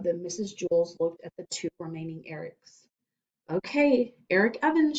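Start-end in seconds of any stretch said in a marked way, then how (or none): 3.5-5.0 teacher voice. Acted. Teacher calling student.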